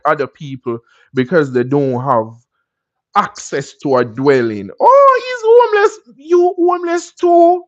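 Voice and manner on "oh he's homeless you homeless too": high, loud, mocking sing-song; high loud taunting voice; mocking snob. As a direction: sneer it out like a snobbish gossip. Mocking people who look down.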